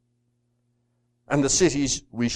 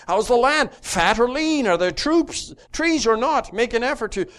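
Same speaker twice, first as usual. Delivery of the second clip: Moses' commands; 6 semitones higher, 4 dB louder, faster than usual. Retold or imitated. Moses instructing the spies, voiced.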